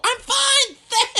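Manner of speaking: high, loud fake-cheery thanks; sarcastic. Sarcastic fake-cheery to rude crowd.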